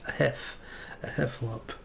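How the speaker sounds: breathy stammered attempts at one word; lightly acted breathlessness; out of breath. A narrator's reading. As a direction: get it out in puffs, too winded to speak. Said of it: "breathing so hard that he could hardly speak".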